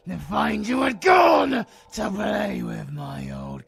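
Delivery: villain shouting mock offense at the girl; indignant shout. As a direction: roar your mock outrage. Villain angrily complains.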